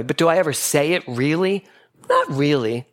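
ordinary talk

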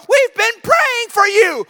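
high, stretched-out gushing; overjoyed. Retold or imitated. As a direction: pour out acted delight, high and slow. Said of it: "she was so overjoyed".

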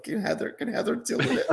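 high-pitched imitation of a team asking for help; mock plea. Team passing an issue to a colleague, mock plea.

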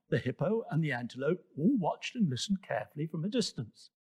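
ordinary delivery

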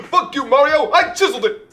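high, loud cartoon Italian plumber, laughing; mock Mario voice. Mario and Luigi impressions.

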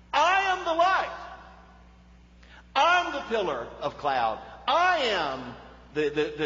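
booming, high declaration of the light; ringing proclamation. Acted. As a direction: proclaim it like Jesus rising before the crowd. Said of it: "Jesus stands up and he says this".

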